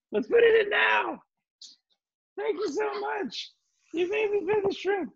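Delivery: playful high-pitched panic; squeal of mock panic. Real, not acted. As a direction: yelp it out like you just remembered the shrimp. Sudden shrimp panic, thanking helper.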